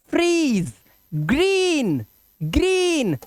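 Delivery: "फ्रीज, ग्रीन" high with held ee; long vowel. Shown as a demonstration. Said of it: "थोड़ा लंबा लो sound".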